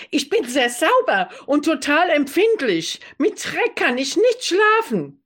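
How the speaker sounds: high, prissy complaining voice; prim fussy roommate. Acted. Roommate insisting she is spotless, high and prissy. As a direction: play the touchy neat-freak roommate.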